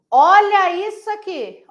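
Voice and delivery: loud, high, drawn-out exclamation; emphatic. Shown as a demonstration. Teacher showing students an error, loud and emphatic. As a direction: stretch it out in loud alarm. Repeats the line, building up.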